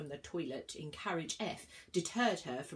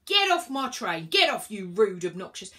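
very loud furious order; yelling; 2 semitones higher, 11 dB louder than usual. A narrator's reading. Inspector yells at Virgo to get off. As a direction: throw her off the train.

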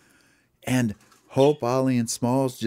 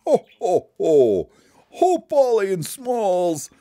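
booming Santa greeting, starting high and falling; loud, hearty ho-ho-ho voice; jolly Santa; 11 semitones higher, 6 dB louder, slower than usual. Acted. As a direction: boom it out like Santa hailing old friends.

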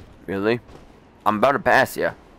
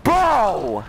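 frustrated yell; 6 semitones higher than usual. Real, not acted. Exclaims after dying.

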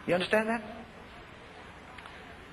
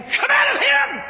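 high, loud, slow drawn-out command; commanding shout; 8 dB louder, slower than usual. Retold or imitated. Preacher repeating the deliverance over the fallen man, commanding shout. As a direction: shout it out long at the demon. Re-enacting casting out a demon.

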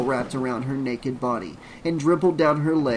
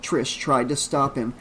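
plain reading voice

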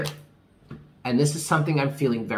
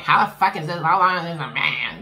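higher, louder impatient outburst; frustrated ego voice; 5 semitones higher, 4 dB louder than usual. Retold or imitated. Ego demanding to know how long, higher and louder impatience. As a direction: voice your own impatient ego demanding answers. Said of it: "my ego is kind of flaring up too, being like".

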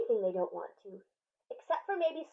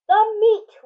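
loud, much higher roar; 9 semitones higher, 12 dB louder than usual. Acted. Crew roars for meat, very loud and high. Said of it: "we all roared".

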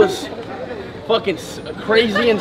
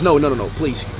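ordinary talk

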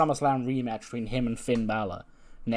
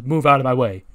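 raised-volume retold command; loud order; 10 dB louder than usual. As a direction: imitate a wrestler shoving past, much louder. Wrestler storms past, shoving someone.

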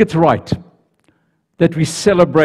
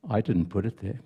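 soft, lower somber line; 6 semitones lower, 12 dB quieter than usual. Acted. God explaining the tear to the angel, soft and somber. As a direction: let the truth land quietly.

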